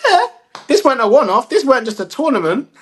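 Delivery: high, confident swagger; cocky young player. Retold or imitated. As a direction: puff up like a kid who knows he's arrived. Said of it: "he's like".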